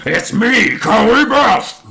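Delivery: high, loud character yell; big theatrical reveal, loud and high; hammed-up shout. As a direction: belt it out, stretching the name. Retold masked girl announcing herself.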